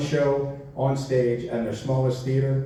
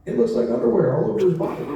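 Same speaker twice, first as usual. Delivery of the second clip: incredulous; 5 semitones higher, 4 dB louder, faster than usual. Retold or imitated. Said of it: "And I was like".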